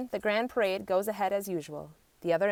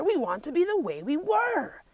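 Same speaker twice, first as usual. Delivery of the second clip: animals pleading; 9 semitones higher than usual. A narrator's reading. Animals answer Nagiri.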